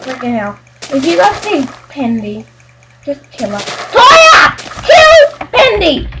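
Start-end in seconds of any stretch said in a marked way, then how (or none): 3.6-6.1 high, loud, slow yell; real battle cry; rallying shout. Real, not acted. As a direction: call out the order across the map. Rallying teammate to attack.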